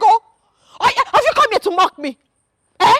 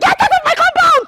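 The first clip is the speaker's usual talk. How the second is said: raised, furious order to leave; furious shout; 8 semitones higher than usual. Acted. Wife screaming at the visitor to leave her compound. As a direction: throw him out with your voice.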